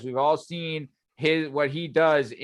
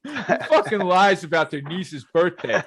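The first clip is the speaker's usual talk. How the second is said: louder, laughing fake indignation; laughing mock outrage; 4 semitones higher, 5 dB louder than usual. Real, not acted. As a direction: roast him while cracking up.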